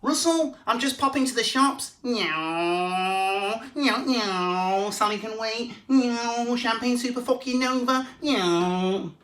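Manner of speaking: high squeaky partner voice with purring meows; Lindsay on a motorbike. Retold or imitated. Comedian imitates his partner for a viewer request, high and meowing. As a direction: camp it up as his partner revving off. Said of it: "impression of Lindsay on a motorbike".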